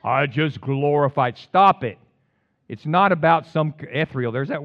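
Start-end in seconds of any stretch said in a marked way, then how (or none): 0.0-1.4 mocking pious voice. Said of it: "if we can learn to glorify God". 1.4-2.0 loud sharp rebuke; sharp stop it. Acted. Preacher rebukes mock quote.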